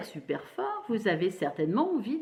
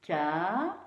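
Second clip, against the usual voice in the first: syllable by syllable; 2 semitones lower than usual. Shown as a demonstration. Teacher placing syllables with pupils, syllable by syllable. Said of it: "placer les syllabes".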